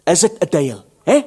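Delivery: high, mocking blackmail threat; cocky taunting threat. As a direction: needle him with the threat.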